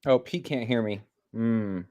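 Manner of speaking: normal talking voice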